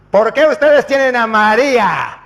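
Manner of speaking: imitated preacher's challenge; loud challenge. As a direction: throw the question at the congregation. Pastor's challenge acted out.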